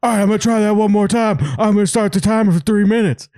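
high, quick mock resolve; fast, high impression of a guy psyching himself up; imitated video guy. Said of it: "he gets up and he goes".

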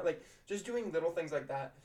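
ordinary delivery

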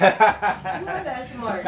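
slow amused repeat, laughing through it. Real, not acted. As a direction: tease him while cracking up. Teasing friend's claim, laughing.

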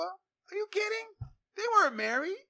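incredulous. Real, not acted. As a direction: stretch out the disbelief. Mocking disbelief in argument.

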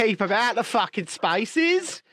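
badly destroyed New Zealand accent; Jacinda. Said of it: "if I'm allowed to do Jacinda".

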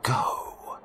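slow, whispery command; sinister inner voice. A narrator's reading. The voice interrupting with orders to Daryl, whispery. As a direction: hiss the broken order under the breath. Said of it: "the voice interrupted".

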